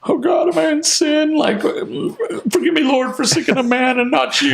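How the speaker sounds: high, thin mock-desperate plea; panicked pleading prayer. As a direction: beg God in a put-on high voice. Acts out his panicked prayer.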